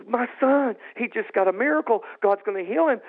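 high-pitched joyful announcement; elated father, reenacted; excited retelling. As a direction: blurt out wonderful news about your son. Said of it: "I was just so excited".

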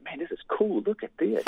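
giddy, delighted at the sight; retelling his own excited wonder, pitched high; caught-up excitement. Retold or imitated. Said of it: "I was caught up in the moment myself".

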